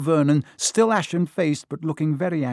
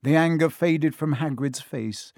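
ordinary delivery, nothing marked

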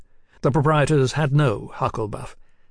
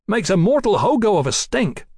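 complaining; 8 semitones higher, 3 dB louder than usual. A narrator's reading. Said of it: "Tom complained".

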